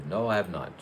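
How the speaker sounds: ordinary delivery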